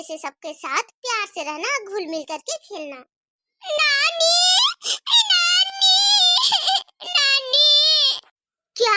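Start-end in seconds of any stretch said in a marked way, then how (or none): 3.6-8.2 high, rising calls for nani, the last drawn out slowly; child shouting for her grandmother from afar; calling out. Acted. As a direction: call for grandma across the house.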